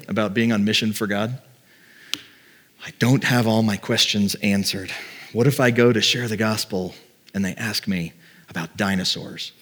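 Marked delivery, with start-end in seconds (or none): 2.8-9.5 softer, lower voice of doubt; hesitant worry. Acted. Preacher voicing a believer afraid to share the gospel, quietly hesitant. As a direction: confess it quietly, as a private worry.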